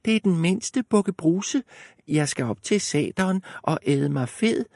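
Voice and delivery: high, thin small goat; thin little voice. Acted. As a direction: squeak it up like the tiniest goat. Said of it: "med sin spinkle stemme".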